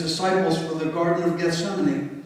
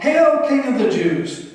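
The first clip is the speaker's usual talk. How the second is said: loud, high sneering hail; mocking hail; 7 semitones higher, 5 dB louder than usual. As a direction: jeer it like a cruel soldier. Knelt before him to mock.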